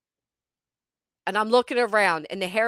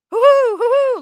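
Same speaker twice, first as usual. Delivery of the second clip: very high, loud hooting owl; loud mock owl laughing; 14 semitones higher, 9 dB louder than usual.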